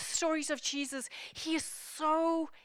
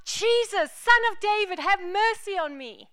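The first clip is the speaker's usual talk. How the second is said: raised, pleading call to Jesus, higher; 5 semitones higher, 4 dB louder than usual. A narrator's reading. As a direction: cry out to be heard over the crowd. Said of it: "He called out".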